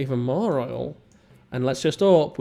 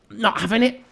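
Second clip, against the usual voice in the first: mock defiance; 8 semitones higher than usual. Defiant refusal.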